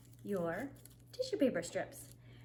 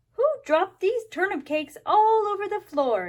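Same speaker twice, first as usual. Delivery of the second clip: loud, high exclaiming father; exclaimed; 8 semitones higher, 7 dB louder than usual. A narrator's reading. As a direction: cry out in dismay over the mess. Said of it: "exclaimed Mister Chan".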